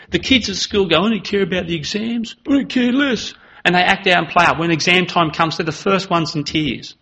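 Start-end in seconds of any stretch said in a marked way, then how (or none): 1.0-3.3 put-on higher, dismissive teen voice; mock careless kid. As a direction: brush it off like a cocky kid. Kids at school mocked.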